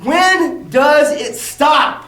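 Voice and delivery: very slow, stretched, stress on when; exasperated, dragged-out repeat; drawn-out plea. Real, not acted. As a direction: drag every word of it out, when lifted. Repeated outraged rhetorical question.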